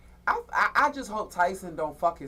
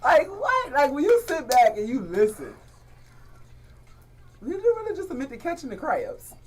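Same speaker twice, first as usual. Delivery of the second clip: disbelief; 10 semitones higher, 9 dB louder than usual. Real, not acted. Shocked by what she admits.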